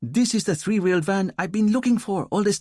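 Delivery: triumphant, finally found it; excited young officer. A narrator's reading. Fidel voiced in the audiobook.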